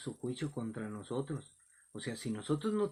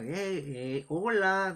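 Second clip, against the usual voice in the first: mock hailing call, higher on the end; imitated heckler; 4 semitones higher, much slower than usual. Young man objects to the preacher, imitated hailing call. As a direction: imitate someone calling out for attention. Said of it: "decía así".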